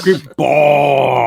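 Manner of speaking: creepy caller voice. Retold or imitated. Mimicking the hotline's creepy caller.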